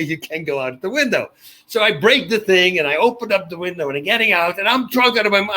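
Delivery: ordinary talk, nothing marked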